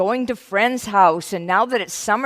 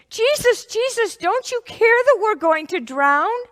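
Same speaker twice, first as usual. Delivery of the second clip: high, loud desperate pleading; frightened plea; 12 semitones higher, 4 dB louder than usual. Acted. Disciples shaking Jesus awake, pleading high and loud. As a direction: beg him to wake up and help.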